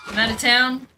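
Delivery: high, loud stern warning; a hint of a stern father laying down the law; retold dad's threat. Dad to her ex on the phone, a loud retold warning.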